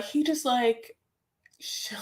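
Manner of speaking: usual speaking voice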